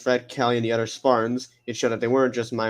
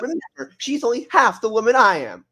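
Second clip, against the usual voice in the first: Cortana; 9 semitones higher, 4 dB louder than usual. Acted. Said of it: "Cortana's telling her about the copy".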